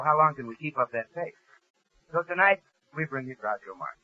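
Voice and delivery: usual speaking voice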